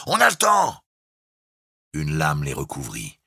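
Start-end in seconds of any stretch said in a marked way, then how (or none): 0.1-0.8 high, loud, different-voiced shout; bosun shouting. A narrator's reading. The bosun shouts reassurance to Jukes over the storm. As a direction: call it over the waves.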